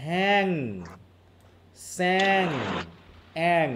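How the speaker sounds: "হ্যাং, স্যাং, অ্যাং" one by one, each -ang left ringing; lingering end sound. Shown as a demonstration. Teacher showing pupils a shared rhyme, "হ্যাং, স্যাং, অ্যাং" with lingering endings. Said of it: "লাস্টের যে একটা rest থাকতেছে সাউন্ডের".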